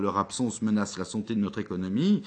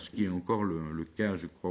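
normal talking voice

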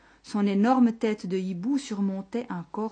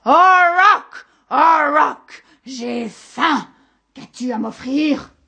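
loud, higher owl-monster screech; monster's shout; 6 semitones higher, 14 dB louder, much slower than usual. A narrator's reading. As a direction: roar out hunger and demand food. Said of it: "Il se remit à crier". (cri puissant de monstre affamé)

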